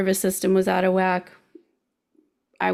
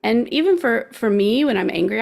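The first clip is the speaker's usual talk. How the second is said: ordinary talk, nothing marked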